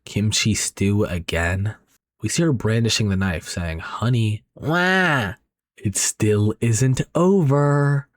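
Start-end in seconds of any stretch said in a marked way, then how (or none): 3.8-8.1 possessed wail. A narrator's reading. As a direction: let the thing inside her speak slowly. Wife brandishing knife, possessed.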